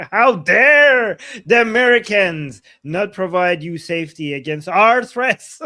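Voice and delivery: sarcastic mock outrage. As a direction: sneer it as fake outrage.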